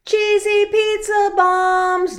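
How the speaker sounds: put-on high, loud, drawn-out little-boy yell; excited yell. Luis calling out to the girls about the treat, yelling. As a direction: holler it with delight, slowly. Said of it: "Luis yelled".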